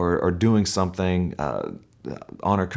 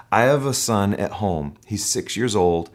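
ordinary talk, nothing marked

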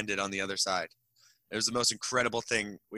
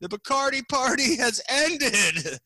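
high, slow, booming PA call; imitating an event announcer over the speakers; loudspeaker announcement; 12 semitones higher, 4 dB louder, slower than usual. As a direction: announce it to a whole party, drawn out. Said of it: "over the loudspeakers".

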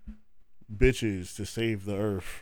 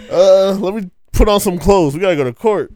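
hurried; 8 semitones higher, 11 dB louder than usual. Pretend. Acted skit, hurrying voice.

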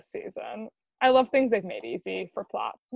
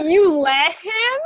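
mock disbelief; 6 semitones higher, 5 dB louder, slower than usual. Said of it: "Dean turns to Cass and goes".

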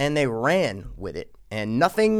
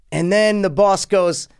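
ordinary delivery

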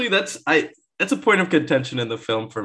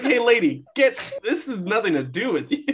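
mock indignant retort; 7 semitones higher than usual. Acted retort to Aunt May.

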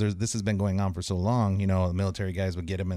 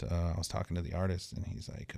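usual speaking voice

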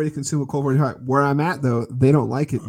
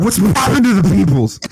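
higher, louder, pumped-up greeting; hyped greeting; 8 semitones higher, 8 dB louder than usual. Real, not acted. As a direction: shout it out with real energy. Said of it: "it's a little bit better".

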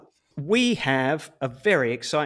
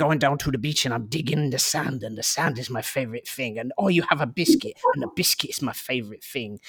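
put-on gruff, simple-minded dog with a mashed-up accent; gruff French bulldog; 2 semitones lower than usual. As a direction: growl it out like an easily delighted little dog. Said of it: "it's quite a gruff voice".

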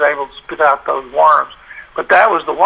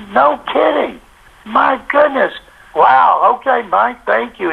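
mildly amazed reporter, pitched higher; imitated amazement; 7 semitones higher, slower than usual. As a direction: react with raised-pitch surprise, as if retelling someone's astonishment. Acting amazed reporter on phone.

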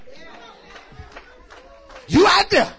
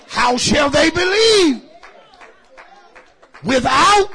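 preaching intonation; 4 semitones higher, 5 dB louder, slower than usual. Real, not acted.

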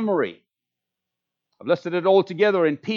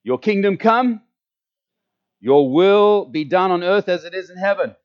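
leading prayer recitation; 5 dB louder than usual. Shown as a demonstration. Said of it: "Starting with me saying".

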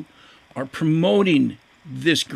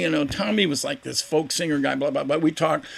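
ordinary talk, nothing marked

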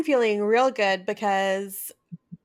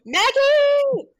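a long sung-out call, higher and held; 4 dB louder than usual. Acted. Hosts calling their cat to the mic, drawn out high. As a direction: call the cat across the house.

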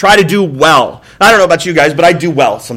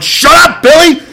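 mock yell; 10 semitones higher, 3 dB louder, slower than usual. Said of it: "you're like".

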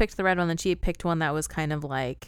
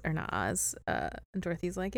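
ordinary talk

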